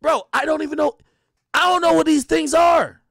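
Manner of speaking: loud, high, fed-up cry; exasperated outburst. Real, not acted. To his audience, loud and fed up with the reality shows. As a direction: throw your hands up in the voice.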